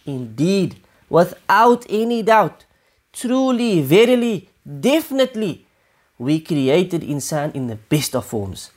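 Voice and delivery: slow, weighty build through "truly, verily, definitely"; powerful emphatic statement. Retold or imitated. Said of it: "as if to make a really powerful statement".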